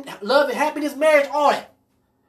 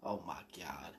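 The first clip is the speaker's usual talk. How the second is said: breathless whispered gasp of disbelief; whispered oh my God to himself; 25 dB quieter than usual. Retold or imitated. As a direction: whisper it in shock. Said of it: "I said".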